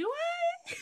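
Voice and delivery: very high, innocent "Do I?"; surprised. Retold or imitated. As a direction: sound genuinely caught off guard. Surprised at friend's remark.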